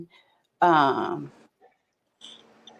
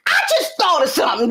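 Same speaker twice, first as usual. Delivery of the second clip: quick, higher, laughing call; playful announcement; 7 semitones higher, faster than usual. Retold or imitated. Mother bursting into daughter's room, laughing and quick. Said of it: "This kinda how I said".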